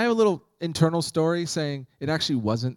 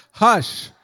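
firm hush; 7 semitones higher, 7 dB louder than usual. Retold or imitated. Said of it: "I just".

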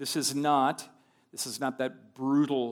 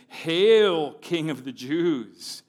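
mocking hail; 4 semitones higher, 8 dB louder, much slower than usual. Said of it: "they came up to him saying".

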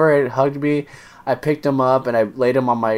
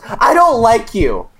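put-on sulky kid voice; 8 semitones higher, 4 dB louder, slower than usual. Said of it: "He goes".

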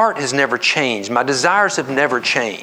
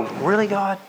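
mild questioning of God; 7 semitones higher, 3 dB quieter than usual. Acted. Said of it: "That I may go".

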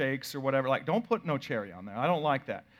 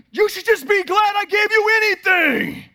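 high angry shout; hammed-up furious yell at his kid; angry dad shouting at daughter in the car; 9 dB louder than usual. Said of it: "And I start yelling".

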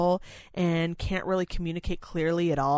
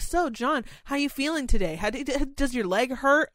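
much higher, eager rapid questions; eager questioning; 7 semitones higher than usual. Retold or imitated. Caregiver eagerly quizzes lucid resident John, much higher. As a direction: pounce on the moment with questions. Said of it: "you're like".

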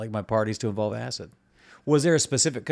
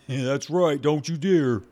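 raised, put-on voice; scolding coworker; 5 semitones higher, 4 dB louder, slower than usual. Coworker warns Steve against thinking, raised scolding character voice. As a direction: warn him off as the bossy workmate.

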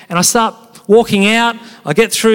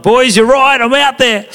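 eager surfer; 7 semitones higher, 3 dB louder than usual. Retold or imitated. Telling mates he's going out.

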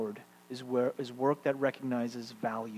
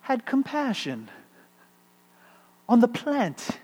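high, slow, declaimed verse; solemn verse reading, raised and drawn out; lyrical recitation; 8 semitones higher, 4 dB louder, slower than usual. Shown as a demonstration. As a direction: chant it like poetry, lifted high. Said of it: "a lyricism to these last two verses that I want to show you".

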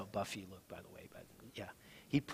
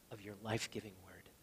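whispered prayer; 4 semitones lower, 13 dB quieter, faster than usual. Acted.

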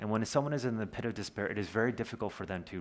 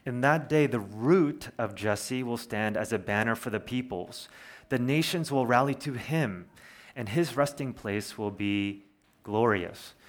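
louder, proclaimed reading of the verse; scripture reading; 5 dB louder than usual. Acted. Quotes verse ten aloud.

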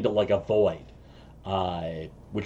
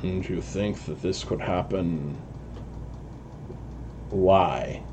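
harsh masked voice; 6 semitones lower, 8 dB quieter than usual. Acted. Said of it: "really harsh voice".

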